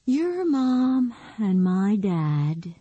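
slow, weary sigh. Acted. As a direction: let it out on a long sigh. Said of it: "with a deep, heartfelt sigh".

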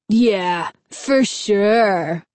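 dry sarcastic agreement; mock him with fake agreement. A narrator's reading. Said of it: "Fred replied sarcastically".

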